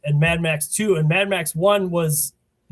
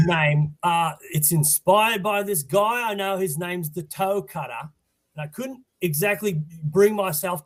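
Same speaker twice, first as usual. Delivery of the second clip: put-on Australian accent; 2 semitones higher than usual. Guest joking about his outlaw name in a mock Australian accent. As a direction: play the Aussie bushman in a hammed-up Australian accent. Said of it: "that's enough with the Australian accent".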